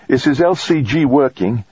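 high-pitched character voice for the doctor; physician on comms. A narrator's reading. Base physician takes the astronaut's call, high-pitched professional voice. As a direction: answer the radio in a high voice.

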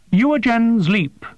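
high, precise voice of a small winged creature; formal, formal alien creature, pitched high. A narrator's reading. Voices the bat-like creature Prath.

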